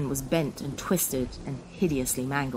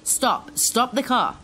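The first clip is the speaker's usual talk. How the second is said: officer's urgent shout, higher and louder; 8 semitones higher, 5 dB louder, slower than usual. Acted. Police officer shouting at the skidding car to stop. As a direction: yell him to a stop.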